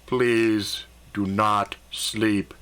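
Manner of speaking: very slow, low, measured command; booming voice in head. A narrator's reading. Alien voice ordering the man awake, slow low boom. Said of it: "A loud voice boomed in my head".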